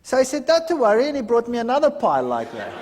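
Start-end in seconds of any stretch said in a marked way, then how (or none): 0.5-1.1 higher and louder breezy "Don't worry"; cheery reassurance. Retold or imitated. Friend cheerfully brushing off the rejection, breezy and loud. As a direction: toss it off brightly, unbothered. Friend unfazed, brings more books.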